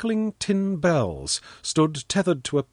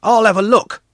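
put-on high, loud boyish voice; volunteer briskly in a raised voice; eager boy; 9 semitones higher, 8 dB louder than usual. A narrator's reading. Pashka climbing a stump to see, loud high boy.

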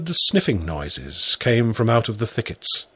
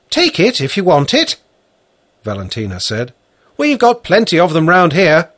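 woman's voice; 7 semitones higher, 5 dB louder than usual. Acted. Valentina speaking.

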